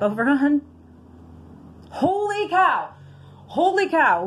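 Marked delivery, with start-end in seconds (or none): 1.9-4.2 amazed. Real, not acted. Big win revealed on ticket.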